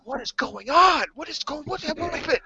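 high-pitched panicked mom voice; high, frantic questioning; alarmed mother. Retold or imitated. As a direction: imitate a startled mom demanding answers. Mother reacting to son's screaming.

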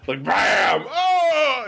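sudden "bam" then a high "oh"; mock shock yelp. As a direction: act out getting zapped. Acting out the shock to the leg.

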